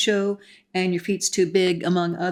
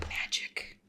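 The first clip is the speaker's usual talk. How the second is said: whisper; 14 dB quieter than usual. Real, not acted. Paging quietly through photos.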